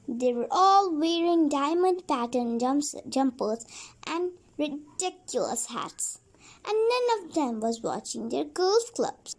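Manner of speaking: plain reading voice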